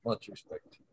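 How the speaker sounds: soft unvoiced whisper through a tight jaw. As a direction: breathe it out, jaw nearly shut. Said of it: "almost like my jaw was wired shut".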